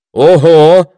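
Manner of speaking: high, loud exclamation; loud surprise. A narrator's reading. Villager hearing how long the campaign lasts, loudly surprised. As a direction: burst out astonished. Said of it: "громко удивился тот же голос".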